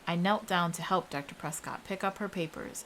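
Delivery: ordinary delivery, nothing marked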